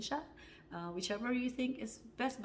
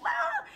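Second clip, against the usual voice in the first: short, high-pitched yelp; high, loud "ah" of pain; retold pained scream; 5 dB louder than usual. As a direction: re-enact a yelp as hair is grabbed. Hair grabbed, re-enacted scream.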